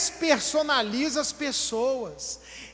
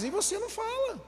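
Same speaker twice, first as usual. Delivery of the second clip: high-pitched bossy retort; acted spouse cutting someone off; mock bossy spouse; 8 semitones higher than usual. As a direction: take over in a high, put-on voice.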